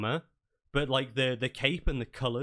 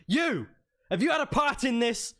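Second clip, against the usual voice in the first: high, loud accusing commander; Yoram; 11 semitones higher, 5 dB louder than usual. Acted. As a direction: round on them with an accusation. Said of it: "locks onto you and be like".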